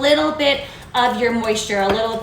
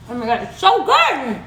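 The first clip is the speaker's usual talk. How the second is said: mouthful of delight; excited. Real, not acted. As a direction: gush over the taste. Tasting the bread.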